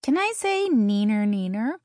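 high singsong taunt; playful high-pitched needling; teasing. A narrator's reading. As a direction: tease like a kid in the playground. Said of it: "Zoe teased".

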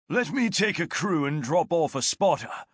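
lightly voiced veteran sergeant; a hint of a steady soldier; sergeant's voice. Martius voiced by narrator.

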